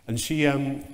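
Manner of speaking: normal talking voice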